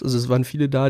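usual speaking voice

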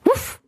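short playful bark; mock dog woof. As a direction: bark once like a small dog. Dog Max barks.

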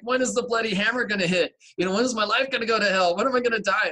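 high, loud, rushing panic as if doom is near; panicked pleading. Retold or imitated. Retelling how she begged to know when disaster would come, loud and high panic. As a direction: cry out the fear of the hammer falling.

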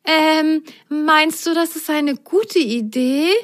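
doubtful. Acted. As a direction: hedge with an unsure ähm. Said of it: "zweifelte Rosenrot".

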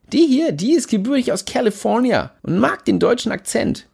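put-on German words in an overdone American accent; exaggerated American accent. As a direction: lay the American accent on thick, mildly. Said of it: "spricht der Kalifornier übertrieben amerikanisch aus".

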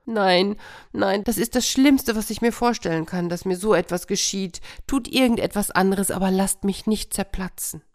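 high, faked pleading hare; mock horror. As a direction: pretend to be terrified.

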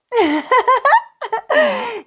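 very high, rapid giggle; a burst of tiny-creature tittering; fairy giggling. Acted. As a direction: giggle away like little fairies. The fairies giggle.

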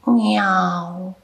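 a small plaintive miaow; cat meow. Acted. Cat Josef answering the angel, small meow. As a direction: say it like a shy cat.

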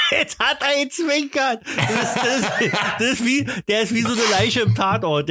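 high giggling words; laughing. Real, not acted. Laughing at frozen partner.

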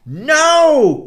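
high, loud defiant no; cry. Acted. Boy refusing the moon, high and loud. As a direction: refuse at the top of the voice. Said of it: "cried the little Havelmann".

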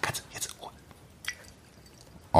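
whispered, nearly silent; hushed whisper. Real, not acted. Host whispering before dropping grapes into the glass. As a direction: hush everyone for the sound.

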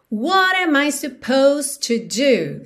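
slow, "supposed" as two syllables; silent E. Shown as a demonstration. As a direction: say "supposed" as "suh-POHZD". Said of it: "esse E aí não é pronunciado".

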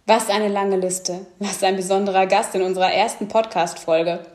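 polished scripted host intro, evenly paced; smooth radio-style welcome to listeners; podcast intro read. Acted. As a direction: read the show opener like a prepared announcement.

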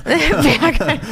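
laughing disbelief. Real, not acted. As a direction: echo it through laughter. Laughs at Berghain suggestion.

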